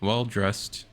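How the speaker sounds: ordinary talk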